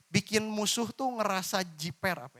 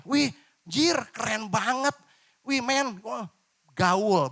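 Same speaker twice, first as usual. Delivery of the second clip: loud, higher slangy street hype; mock slangy hype; 4 semitones higher, 5 dB louder, slower than usual. Shown as a demonstration. Preacher demonstrates crude youth slang, hammed-up and loud. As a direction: play a swaggering kid showing off with slang. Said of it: "Ini contoh ya".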